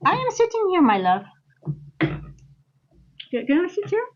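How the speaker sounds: playful high voice for the pet; pet talk voice. Real, not acted. Talking to pet about chair.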